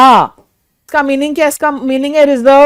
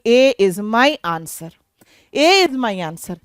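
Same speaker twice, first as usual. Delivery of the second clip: slow dictation; 3 semitones lower, 4 dB quieter, much slower than usual. Shown as a demonstration. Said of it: "announcing the answer".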